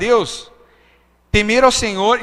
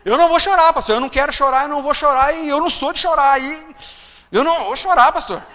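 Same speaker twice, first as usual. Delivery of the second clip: tearful insisting; 6 semitones higher, 4 dB louder than usual. Retold or imitated. Retold refusing to cry.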